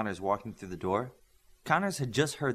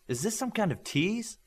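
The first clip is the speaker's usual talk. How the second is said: higher, wary, half-accusing question; suspicious; 10 semitones higher than usual. Acted. Virtuoso asking the coach about the magazines, suspicious. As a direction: suspect a trick. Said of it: "Virtuoso asked Connors".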